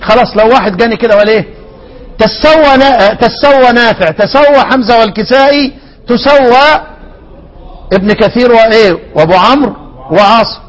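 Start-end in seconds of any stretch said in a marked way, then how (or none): 2.2-6.8 تسوى sounded out in its readings, tusawwa, tassawwa, tasawwa; demonstrating Quran readings. Shown as a demonstration. Teacher to students, demonstrating each reading of تسوى. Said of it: "يبقى أنا عندي كام قراءة في هذه الكلمة".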